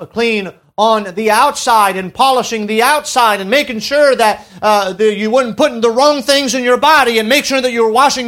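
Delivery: loud, building pulpit preaching; full-force sermon voice; fervent preaching. Acted. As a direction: press the point on the crowd.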